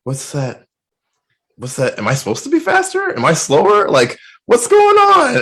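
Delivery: rising, high-pitched confused questions; baffled. Retold or imitated. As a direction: act out cartoonish bewilderment, building to a high cry. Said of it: "giant question mark above my head".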